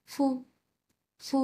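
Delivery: ফু said short and quick, no tone; neutral tone. Shown as a demonstration. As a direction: clip ফু fast and light. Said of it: "একটু তাড়াতাড়ি উচ্চারণ করব".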